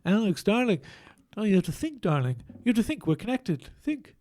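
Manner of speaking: nasal held-nose conductor voice; Maestro voice. Pretend. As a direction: pinch your nose and scold darling. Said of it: "the Maestro voice".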